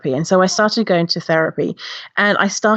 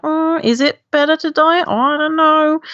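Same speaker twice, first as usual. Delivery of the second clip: doubtful wavering voice; 10 semitones higher than usual. Retold or imitated. Voices unsure person looking back.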